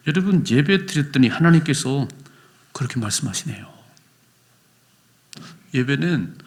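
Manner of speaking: normal talking voice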